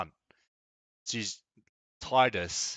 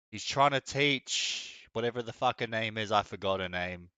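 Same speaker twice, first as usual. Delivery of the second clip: ordinary delivery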